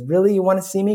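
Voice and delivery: high, disbelieving puppet voice; hopeful Punchinello. Acted. Punchinello doubts he is wanted, high hopeful voice. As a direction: ask it high, in hopeful surprise. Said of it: "he goes".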